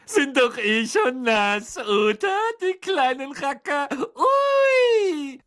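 high, slow taunting ghost voice building to a loud squeal; mock them, then whoop; gleeful poltergeist. Peeves taunts the pupils. (hämischer Poltergeist, hoch, endet mit lautem Juchzer)

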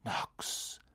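soft whispered spell. Acted. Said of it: "flüsterten sie wie aus einem Mund".

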